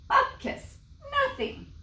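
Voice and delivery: a dismissive hiss then a high snap; scoffing. Acted. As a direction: wave the rabbi off. Wife scoffs at the rabbi.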